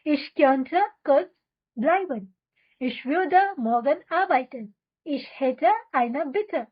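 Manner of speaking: very slow, every syllable laid out for repeating; slow drill reading. Shown as a demonstration. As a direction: read it slowly so learners can echo you. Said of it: "तो चलो एक साथ बोलते हैं". (बहुत धीमे, साफ़ साफ़ दोहराने के लिए)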